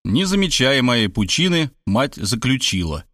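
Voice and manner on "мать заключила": ordinary delivery, nothing marked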